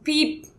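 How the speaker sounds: high, soft imitation of a smoke alarm chirp; small high-pitched electronic beep; sharp little beep. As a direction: beep like a dying alarm battery. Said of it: "it's like a sharp little beep".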